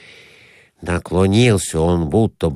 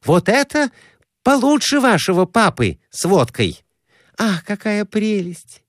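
high-pitched admiring woman; delighted Glafira Semyonovna; 12 semitones higher than usual. A narrator's reading. Woman comparing Vesuvius to her companion's dream, delighted. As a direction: marvel in a high feminine voice.

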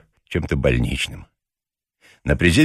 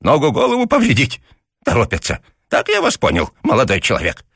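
put-on grumbling old man; 8 semitones higher than usual. A narrator's reading. Papasha lectures.

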